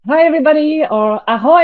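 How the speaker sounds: ordinary talk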